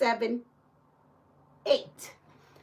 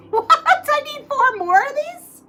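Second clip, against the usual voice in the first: high, louder question through a laugh; laughing disbelief; 13 semitones higher, 5 dB louder than usual. Real, not acted. Realises she is four short.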